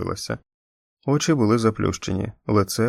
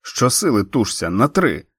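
urging call; 2 semitones higher, 4 dB louder than usual. A narrator's reading. Erin urging the push.

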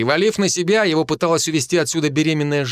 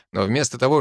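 plain reading voice

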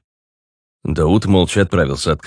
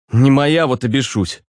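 huffy retort, pitched up; snorting; 7 semitones higher than usual. A narrator's reading. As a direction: toss it off with a snort. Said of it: "фыркнул Руслан". (фыркая, раздражённо)

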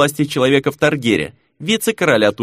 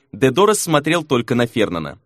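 ordinary delivery

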